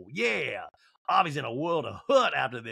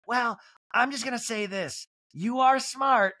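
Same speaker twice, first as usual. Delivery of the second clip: Kyle impression; 9 semitones higher, slower than usual. Recapper voices Kyle.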